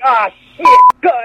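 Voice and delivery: high, loud exasperated curse; mock frustrated outburst. Retelling a wrestler's botched take, mock exasperated shout. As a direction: imitate someone blowing a take.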